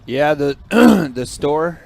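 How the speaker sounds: hoarse, with throat clearing; frog in throat. Real, not acted. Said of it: "I apologize for the frog in my throat".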